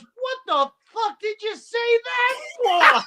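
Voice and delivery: high, incredulous retold shout; outraged yell. Man in charge scolding the comedian for his joke, outraged.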